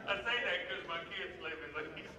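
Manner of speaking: fast, words through laughter; laughing while talking. Real, not acted. Preacher explaining his joke to the congregation, still laughing. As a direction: keep laughing as you explain.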